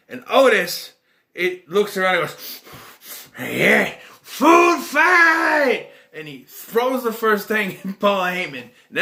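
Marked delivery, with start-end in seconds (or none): 3.4-5.9 high, loud hammed-up yell; mock battle cry. Otis kicking off the food fight, high hammed-up yell. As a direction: roar it like a kid starting chaos.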